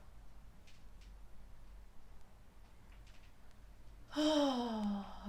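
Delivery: real weary sigh; long heavy sigh. Real, not acted. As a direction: let out a long tired sigh. Weary after painful admission.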